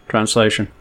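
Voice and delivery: ordinary talk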